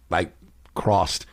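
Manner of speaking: ordinary delivery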